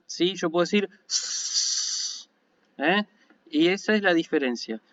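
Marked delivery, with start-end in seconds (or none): 1.1-2.3 "s" as one long, steady hiss drawn out slowly; held s sound. Shown as a demonstration. Teacher showing students that sigma can be held, a slow, sustained hiss. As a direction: stretch the "s" into a continuous hiss. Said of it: "el sonido de la sigma".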